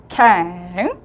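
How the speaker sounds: 캥 said low and short; model word. Shown as a demonstration. Teacher models 캥 after explaining the vowel. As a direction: demonstrate 캥 cleanly. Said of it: "캥이라고 발화가 돼요".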